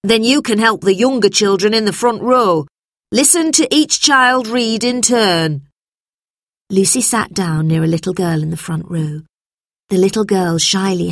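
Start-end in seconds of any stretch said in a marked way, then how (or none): 0.0-5.7 higher-pitched classroom command; teacher instructing. A narrator's reading. Teacher assigns Lucy the younger children, commanding. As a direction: direct the pupil in a raised teacher's voice. Narrator plays teacher giving orders.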